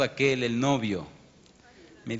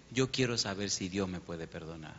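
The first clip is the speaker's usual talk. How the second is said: hushed plea; 6 semitones lower, 7 dB quieter than usual. Retold or imitated.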